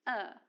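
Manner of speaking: "er" as a short neutral schwa; schwa sound. Shown as a demonstration. Teacher shows the weak vowel, a schwa "er". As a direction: say "er" as a reduced vowel, no r. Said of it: "vowel sound of the word is pronounced".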